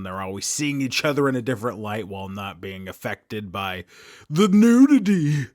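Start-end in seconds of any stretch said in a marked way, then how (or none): 4.3-5.5 mock shocked emphasis.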